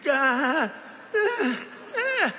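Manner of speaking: mock the weightlifter failing the lift; straining grunt. Weightlifter straining under the bar, high mock grunts. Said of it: "she's like this".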